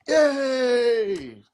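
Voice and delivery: put-on high cheer of kids. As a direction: cheer like a happy kid.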